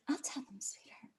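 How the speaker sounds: whispered, playful and coy; a sly whispered promise to her partner; teasing whisper. Retold or imitated. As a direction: breathe it to him like a secret. Teasing reply to partner's question.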